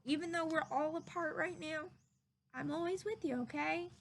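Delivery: put-on soft, gentle comfort to a child; quiet loving goodbye; tender reassurance. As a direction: hush it like a promise to a small friend. Tender parting words.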